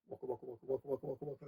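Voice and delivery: soft, low waka-waka rotor sound; rotor noise imitation. Telling how the helicopter limped away, soft rotor imitation. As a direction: mimic a helicopter slinking off.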